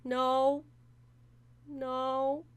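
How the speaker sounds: loud flat refusal; emphatic no. Pretend. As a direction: fire back a hard no. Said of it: "Do you wanna feel even better?".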